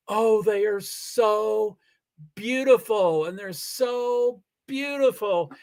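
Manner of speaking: drawn-out, gushing "so beautiful"; gushing mock quote. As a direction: mimic the crews swooning over beauty. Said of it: "they were always like".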